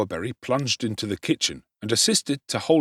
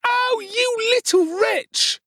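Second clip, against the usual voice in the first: high, slow furious scream; 4 dB louder, slower than usual. A narrator's reading. Charlotte screams at Oliver while seizing him. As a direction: shriek it at him. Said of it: "screamed Charlotte".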